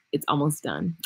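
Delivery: low and soft, letting the secret slip; hushed secret. Real, not acted. Hushed teaser to her viewers before the big cheer. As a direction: murmur it like a secret reveal.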